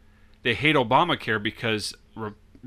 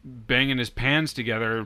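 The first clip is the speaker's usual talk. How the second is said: ordinary talk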